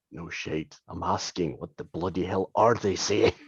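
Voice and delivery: low, grumbling dwarf retort; deep dwarf voice under the breath; Bragg. Acted. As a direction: mutter it deep and irritated. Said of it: "he responds back in Dwarven".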